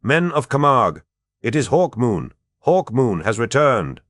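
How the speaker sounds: high, loud rallying yell; rally them with a battle cry. A narrator's reading. Hawkmoon calling to the Camargue defenders, loud rallying yell. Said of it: "drew his sword and yelled".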